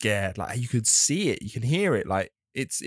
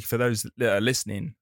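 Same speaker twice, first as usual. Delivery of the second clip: normal talking voice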